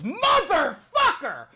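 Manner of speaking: high, loud, drawn-out mock roar; hammed-up furious dad shout; exploding dad. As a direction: imitate a father losing his temper. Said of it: "And my dad goes".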